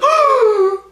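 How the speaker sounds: very high, loud mock gasp; crowd gasp. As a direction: imitate a shocked crowd with a shrieking gasp. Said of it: "the crowd would be like".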